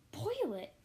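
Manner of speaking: high, startled outburst; aghast gasp. A narrator's reading. Elephant hearing of the threat to the speck, aghast. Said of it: "gasped Horton".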